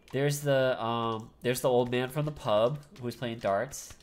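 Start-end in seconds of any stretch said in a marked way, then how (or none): none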